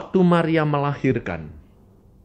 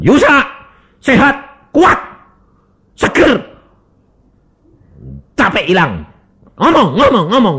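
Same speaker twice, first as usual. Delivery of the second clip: higher, loud declarations; loud self-blessing; 9 semitones higher, 9 dB louder than usual. Acted. Preacher lays hands on himself, proclaiming loudly. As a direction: proclaim each word to yourself.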